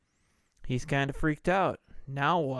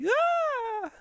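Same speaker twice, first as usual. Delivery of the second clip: sudden very high yelp of panic; high startled scream, imitating the dude; imitated shriek. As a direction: shriek like a man with a ferret in his bath. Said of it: "he does some great shrieks".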